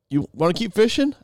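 imitating Jerry. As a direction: ask it brightly up high. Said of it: "And he's like".

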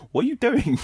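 shrill, high what are you doing; baffled shriek of disbelief. Retold or imitated. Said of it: "[name] just looks like".

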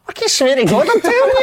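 very high, loud mock pleading; imitated high-pitched oath; hammed-up protest. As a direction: squeal it loudly, insisting you're honest. Acted protest at being called liar.

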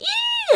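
very high short squeal of joy; excited squeal. Shown as a demonstration. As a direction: let out a tiny giddy squeal. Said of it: "kind of squeal".

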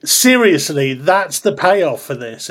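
mock outrage at a punchline; indignant audience. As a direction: demand it like a cheated listener. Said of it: "people going".

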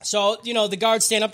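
ordinary delivery

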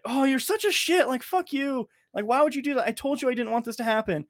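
shrill-high mock outrage at a friend; high mock scolding. Friend imitating the host scolding Gemma, high mock anger. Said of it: "they're like".

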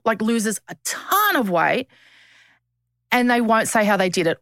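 ordinary talk

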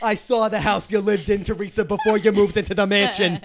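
loud, hammed-up Danielle jab; mock Danielle. Playing Danielle, taunting Teresa with a loud jab. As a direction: spit the insult like a reality diva. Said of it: "a different accent that I've never heard her ever say".

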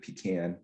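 "pecan" said PEE-can; regional pronunciation. Shown as a demonstration. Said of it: "other folks in the country who".

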